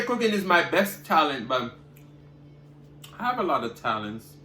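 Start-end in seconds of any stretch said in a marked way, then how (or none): none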